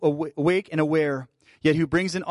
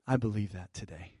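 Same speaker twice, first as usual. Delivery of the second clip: soft declaration, softer; 7 semitones lower, 10 dB quieter than usual. Real, not acted. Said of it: "I'm just declaring it to you".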